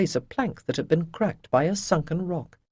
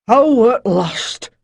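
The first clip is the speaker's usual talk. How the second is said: slow, higher croaking sailor; defiant croak; 6 semitones higher, 9 dB louder, much slower than usual. Acted. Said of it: "he croaked defiantly".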